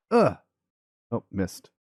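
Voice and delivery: ordinary talk, nothing marked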